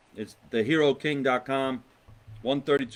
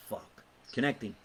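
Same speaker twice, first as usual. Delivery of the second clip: usual speaking voice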